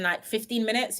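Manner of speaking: ordinary delivery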